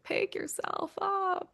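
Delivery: soft, high and slow, almost under her breath; a soft private nudge while reading the script; gentle self-urging. Retold or imitated. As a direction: coax herself quietly and slowly. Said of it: "I was kinda like".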